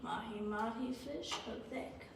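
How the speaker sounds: softly whispered, slow. Acted. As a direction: breathe the dish name under your breath. Repeating the menu dreamily.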